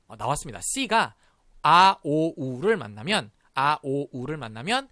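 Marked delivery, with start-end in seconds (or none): none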